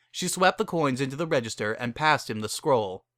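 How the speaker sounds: plain reading voice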